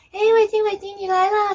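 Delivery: high, loud delighted welcome; excited greeting. Retold or imitated. Two angels rushing out to greet her, excited.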